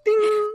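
high, ringing "ding"; imitated china ding. Shown as a demonstration. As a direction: imitate a teacup chime, very high.

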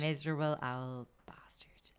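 unvoiced whispered jab. Real, not acted. Cohost mocking the ex-boyfriend in a whisper. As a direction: hiss it under your breath like gossip. Joking insult whispered aside.

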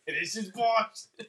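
put-on slow, loud, drawn-out Boston accent. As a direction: belt it out in a thick Boston accent.

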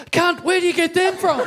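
high, eager mock blurt; high excited imitation of a beer fan; excited retold demand. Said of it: "ran up to him and went".

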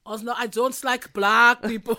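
mock Schwarzenegger. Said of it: "I can hear him say that".

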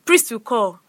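ordinary delivery

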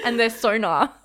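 usual speaking voice

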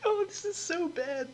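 cringe at the joke; pained groan. Real, not acted.

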